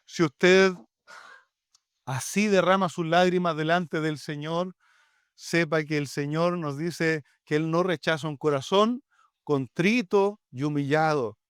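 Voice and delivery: normal talking voice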